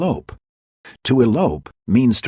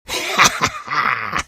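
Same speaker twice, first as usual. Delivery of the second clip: high impish laugh. Acted. Puck laughing.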